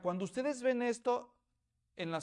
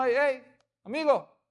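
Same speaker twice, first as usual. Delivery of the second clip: calling out; 4 semitones higher, 6 dB louder than usual. Acted. Calling the passerby over.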